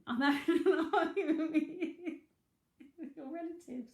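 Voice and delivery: giggling about squabbling with the dead; laughing. Real, not acted. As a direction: laugh as you confess the argument. Laughing while arguing with spirit.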